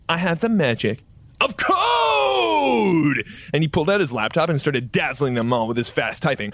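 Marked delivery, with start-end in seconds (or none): 0.1-3.2 high, slow hammed-up boast; triumphant shout. Mark boasts of his coding magic to the crowd, hammed-up shout. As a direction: bellow it like a hero's catchphrase. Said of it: "And Mark said".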